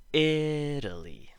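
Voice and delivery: "Italy" with the "I" high, loud and drawn out; slow, exaggerated stress on the start of "Italy"; emphasized first syllable. Shown as a demonstration. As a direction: hold the first vowel of "Italy", then drop through a tapped T. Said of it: "more examples".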